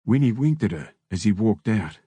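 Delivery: plain reading voice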